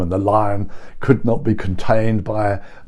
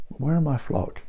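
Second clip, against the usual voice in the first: tender longing; 4 semitones higher, 6 dB quieter than usual. Acted. Jesus longing for his flock.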